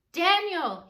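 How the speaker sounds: a high, loud anxious call; crying out. Acted. King calling loudly into the lions' den at dawn. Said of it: "he cried".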